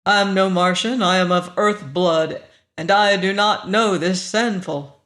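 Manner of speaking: usual speaking voice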